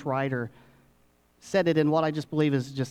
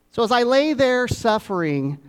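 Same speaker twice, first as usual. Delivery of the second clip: higher and louder, hamming up "lay" as a sufferer; mock dramatic; 8 semitones higher, 5 dB louder than usual. Shown as a demonstration. Preacher jokes to the congregation about his sickbed, hammed-up dramatic. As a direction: play the tragic sickbed martyr. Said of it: "I know that sounds a little dramatic".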